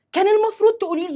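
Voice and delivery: high, loud, quick blame; reproachful. Acted. Woman blaming her sister for not telling her, reproachful. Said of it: "يبقى أنا بلومها".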